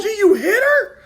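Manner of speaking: high, loud disbelieving cry through laughter; half-laughing shocked shout. Retold or imitated. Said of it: "I said".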